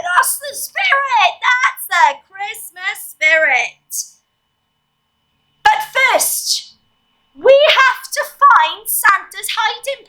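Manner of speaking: put-on playful elf host talking to children; animated elf presenter, stretching out key words; chirpy Santa's helper rallying a class; squeaky Christmas elf.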